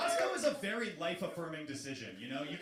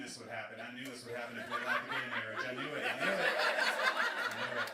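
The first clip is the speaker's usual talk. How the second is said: mock outraged bigot; 2 semitones lower, 6 dB louder than usual. Mock bigot rant, building louder.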